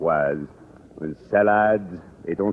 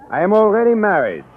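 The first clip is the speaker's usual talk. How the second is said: shocked; 12 semitones higher, 6 dB louder than usual. Acted. Monet corrects misunderstanding.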